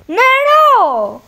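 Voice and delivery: very high, loud yell. Real, not acted. Shouting to grandma in another room for water, a very high loud yell. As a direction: holler across the house for water.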